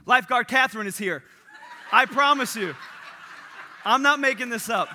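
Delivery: laughing mock hero call; heroic announcement. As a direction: announce yourself like a rescue hero. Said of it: "She says".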